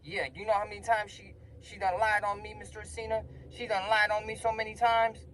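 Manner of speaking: high, worked-up protest; pumped up. Retold or imitated. Student telling Mister Racina she lied on him, pumped up. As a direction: complain all fired up, pitched high. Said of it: "I'm pumped up".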